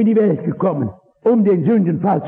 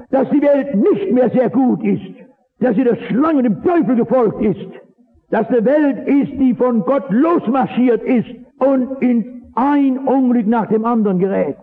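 raised, higher fervent preaching voice; preaching at full force; 7 semitones higher than usual. Acted. Preacher warning his brothers of the fallen world, in full force. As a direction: preach it out, pitch climbing.

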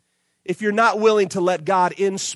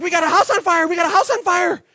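urgent call; 10 semitones higher than usual. Retold or imitated. Calling 911 about fire.

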